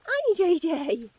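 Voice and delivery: high sweet voice for the pony; talking to a pony. Real, not acted. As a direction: coo a hello to the pony. Greeting pony, high far voice.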